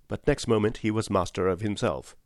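plain reading voice

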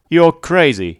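loud, high angry outburst; exploding. A narrator's reading. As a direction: blow up at him. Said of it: "he exploded".

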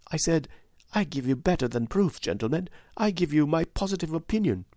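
a loyal widow praising her lord; humble, heartfelt widow; earnest widow. A narrator's reading. Voiced widow in the story.

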